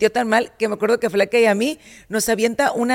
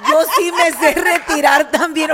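laughing, louder and slower, higher up; laughing brag; 5 semitones higher, 5 dB louder, slower than usual. Real, not acted. Cohost to friends, laughing proudly. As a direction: boast through real laughter. Bragging she knows to quit.

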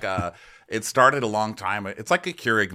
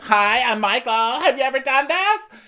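instructor voice; 6 dB louder than usual. Retold or imitated. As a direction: greet her like an overeager fitness coach. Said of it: "And he's like".

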